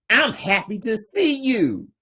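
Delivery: light up with relief; happy. Acted. Rescued Tanisha greets Frank, happy and bright. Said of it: "Tanisha happy".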